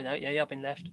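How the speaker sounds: ordinary delivery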